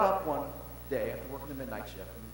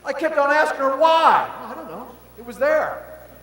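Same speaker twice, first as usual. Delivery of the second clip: loud and high, storming along; shouted sermon story; 14 semitones higher, 18 dB louder than usual. Acted. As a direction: preach the tale at full volume. Loud preaching build.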